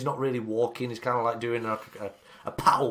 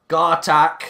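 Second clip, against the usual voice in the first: brief, loud raised call; acted shout; 5 semitones higher, 9 dB louder than usual. Keeper calling to someone off, acted shout. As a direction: call the name out firmly. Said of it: "you hear him shout".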